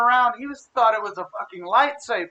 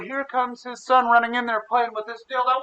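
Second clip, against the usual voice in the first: ordinary talk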